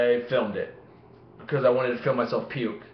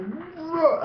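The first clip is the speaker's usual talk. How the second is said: retold reaction. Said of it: "I don't know, I'm not like".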